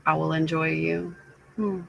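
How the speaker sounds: normal talking voice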